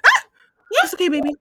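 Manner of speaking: high, loud mock cooing; hammed-up panicked soothing; soothing a scared dog. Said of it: "She said".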